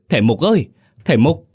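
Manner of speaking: high loud call through the door; old mother banging on the door and calling. Acted. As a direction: shout to wake the master. Pounding door, calling the master.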